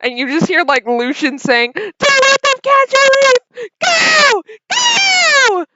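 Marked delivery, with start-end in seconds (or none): 1.7-5.6 very high, loud fleeing yell; panicked shout. Acted. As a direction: call out frantically to a fellow runaway. Lucian calls out while fleeing.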